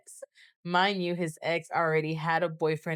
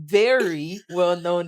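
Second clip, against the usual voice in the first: "very" punched louder for emphasis; stress on VERY; 7 dB louder, slower than usual. Real, not acted. Storyteller describing the equally well-known ex, stress on "very". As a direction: hit "very" hard, as if in capitals. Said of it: "Very capitalized".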